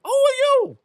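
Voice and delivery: high, loud indignant demand. Real, not acted. Indignant challenge to people who say she should humble herself. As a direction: throw it back at them, high and loud. Heated rant about humility.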